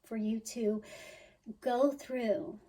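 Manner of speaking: usual speaking voice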